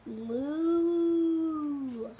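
loud, long, high moo; cow moo. Shown as a demonstration.

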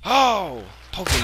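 sudden loud yelp; startled shout at the screen; surprised yelp. Real, not acted.

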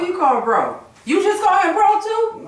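incredulous, high-pitched challenge; indignant challenge. Real, not acted. Challenging someone over "bro.

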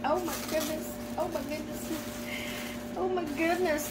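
high, breath-taken amazement; awed. Real, not acted. Gift opener finding another yellow outfit, awed. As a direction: repeat it in high, hushed wonder, then build.

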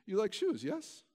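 a hint of a pushy Russian shop clerk; Russian woman. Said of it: "And she says".

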